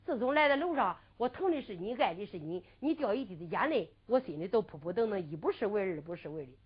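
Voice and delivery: quiet, affectionate reassurance; tender soothing. Acted. Soothing the crying companion.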